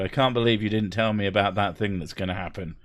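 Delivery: normal talking voice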